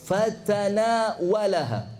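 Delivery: ordinary delivery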